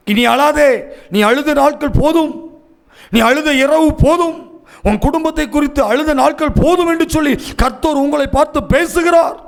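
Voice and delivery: high, insistent preaching; fervent proclamation. Real, not acted. Preacher tells families their days of weeping are over, fervent and high. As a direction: proclaim it as a word from God, pitch held high.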